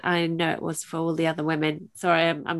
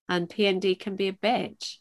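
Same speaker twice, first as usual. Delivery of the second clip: usual speaking voice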